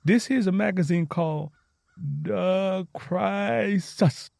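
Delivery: drawn out slowly word by word; slow and steady. A narrator's reading. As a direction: point at each word, reading it out slowly. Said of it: "Tangy said slow and steady".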